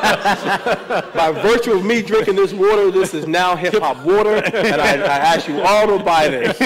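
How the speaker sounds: loud, laughing mock sales pitch. Pretending to sell hip hop water, loud laughing pitch.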